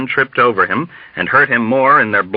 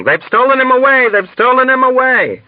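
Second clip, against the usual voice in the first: high, frantic repeated cries; mass screaming panic; 4 dB louder than usual. A narrator's reading. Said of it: "all over the ward began screaming".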